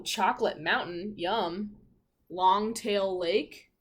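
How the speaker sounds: ordinary delivery, nothing marked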